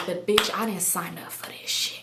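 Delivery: whispered aside. Real, not acted. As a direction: whisper it behind his back. Aside to viewers.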